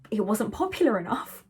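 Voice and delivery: a hushed, deadpan joke aside; whispered aside. Real, not acted. Telling viewers the wrap was discontinued, whispered aside. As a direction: whisper it like a secret.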